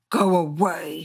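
rasping. Acted. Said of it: "she rasped".

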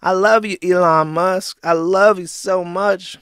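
loud, gushing mock fan; Ethan Ralph. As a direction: gush in a mocking impersonation. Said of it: "and is like".

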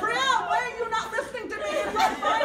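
high, loud panicked yelling through laughter; imitating a husband in full panic; panicked shouting. Husband yelling at his wife that popcorn burns, loud panicked mock yell.

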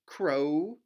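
loud rising crow call. Shown as a demonstration. Teacher leading kids in a crow call, loud. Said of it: "Can you crow?".

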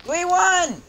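high, loud triumphant shout; real cheering; victory cheer. Real, not acted. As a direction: celebrate the win. Winning the match.

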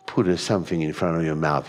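ordinary talk, nothing marked

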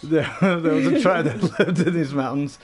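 giggles breaking into the words; laughing through reading. Real, not acted. Reading the tribe note to a friend, laughing. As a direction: try to read through the laughter.

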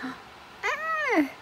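real delighted shriek; surprised. Real, not acted. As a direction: gasp and squeal at the surprise. Surprise at a figure in box.

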